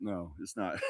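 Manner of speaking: real laugh through the words. Real, not acted. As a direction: answer yourself with a chuckle. Laughs at his own joke.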